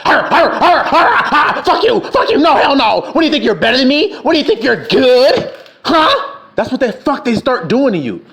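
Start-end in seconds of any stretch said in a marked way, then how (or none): 0.1-6.4 a hammed-up snarling brother lashing out; hostile snarl. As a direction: snarl and sneer like a trapped dog. Brother acted as snarling ingrate.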